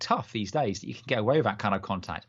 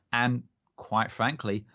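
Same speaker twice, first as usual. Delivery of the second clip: ordinary talk, nothing marked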